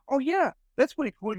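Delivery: high-pitched mock admiration; impressed reaction. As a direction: imitate someone brightly impressed. Retold admiring reaction to flag knowledge.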